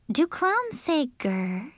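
high, soft, slow question; puzzled girl. A narrator's reading. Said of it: "she asked".

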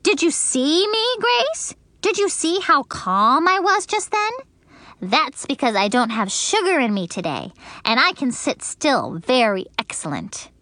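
put-on high proud little girl; joyful. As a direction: show off being calm, thrilled. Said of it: "I clapped my hands very joyful".

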